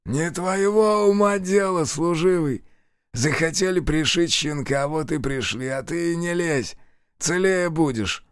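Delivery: high, slow sneering convict voice; sneering thug. A narrator's reading. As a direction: spit it out with a sneer. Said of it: "губы скривились в злой усмешке".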